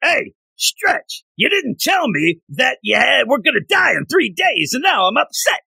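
the rocky hero whining at Stretch in a cartoon voice; the Thing voice. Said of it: "Here's my thing".

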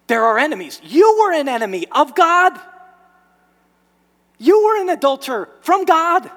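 high, loud, impassioned sermon voice; fiery preaching. Acted. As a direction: drive the point home at full force. Preaching at full force.

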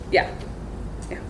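usual speaking voice

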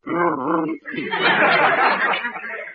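high, hooting cartoon guffaw; a dopey high-pitched laugh; goofy hyuck laugh; silly goofy laugh. Comic laugh after a joke.

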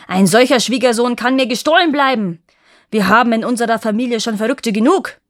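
angry king. A narrator's reading. King rejects the prince as a madman, shouting angrily. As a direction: keep yelling, fed up and scornful. King still raging at suitor.

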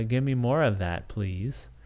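usual speaking voice

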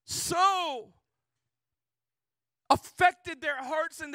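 loud, high-pitched preaching at full force; fiery preaching. Acted. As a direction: preach it out to the whole congregation.

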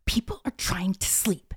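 hushed, slow whispered shushing; imitated whisper. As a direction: shush the kid without waking anyone. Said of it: "her mom's like".

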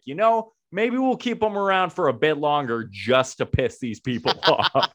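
sly mock resident, amused; lightly voiced smug local; petty residents voice. Voicing petty Ottawa residents.

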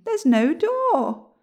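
high, slow, loud bafflement; baffled. A narrator's reading. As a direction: point it out slowly, high and puzzled. William puzzled outside doorless tower.

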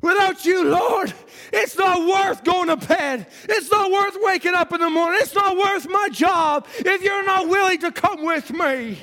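mild shouted plea to the Lord; fervent prayer. Acted. Preacher models a young person's prayer, fervent shouting. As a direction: pray it out, fervent and pleading.

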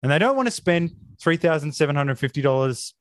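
normal talking voice

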